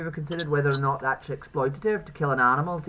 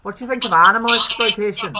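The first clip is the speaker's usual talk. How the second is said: raised voice, higher and much louder; louder repeat; 5 semitones higher, 9 dB louder than usual. Real, not acted. Streamer repeats the question for a listener who missed it, loudly. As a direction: say it up so they catch it.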